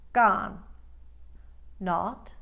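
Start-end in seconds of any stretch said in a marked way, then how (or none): 0.1-0.6 "gone" with wide ah as in spa; loud Long Island "gone", fully open o; Queens vowel. Shown as a demonstration. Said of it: "all three variations".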